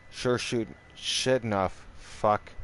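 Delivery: normal talking voice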